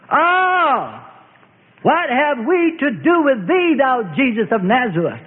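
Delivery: high, anguished demon cry; a tormented spirit shrieking in protest. A narrator's reading. Demon of fear cries out at Jesus, high anguished shriek. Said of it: "The demon of fear cried out".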